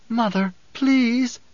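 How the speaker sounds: high, slow, drawn-out plea; pleading. A narrator's reading. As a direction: beg your mother, stretching the please. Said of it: "the pleading".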